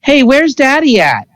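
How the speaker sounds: lightly voiced child; child's voice. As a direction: play the small kid asking sweetly. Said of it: "was like".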